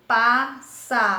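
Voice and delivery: PA - SSA - POR - TE, loud, separate beats; teacher sounding out passaporte; read in syllables. Shown as a demonstration. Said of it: "Olha como divide".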